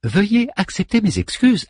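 servant robot voice. Acted. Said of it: "de cette voix quasi masculine".

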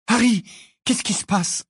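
put-on high-pitched anxious girl's question; frightened. A narrator's reading. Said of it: "l'air effrayé".

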